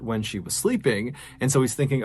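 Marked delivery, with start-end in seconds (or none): none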